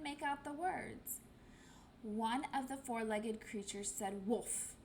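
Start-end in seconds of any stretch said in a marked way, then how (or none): 4.3-4.7 dog bark as a word. Acted. Dog barks.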